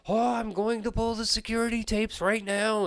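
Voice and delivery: frantic excitement. Retold or imitated. Clerk rushing to pull the security tapes, high and frantic. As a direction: blurt it like she's already running.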